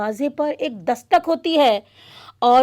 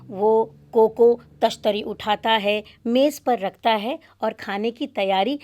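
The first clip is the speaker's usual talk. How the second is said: ordinary delivery, nothing marked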